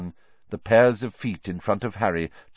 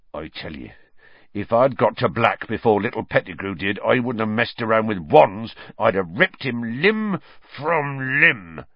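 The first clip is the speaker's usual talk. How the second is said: growled; 5 semitones higher, 5 dB louder than usual. Acted. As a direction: growl it like a big angry gamekeeper. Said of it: "Hagrid growled".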